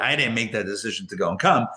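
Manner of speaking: normal talking voice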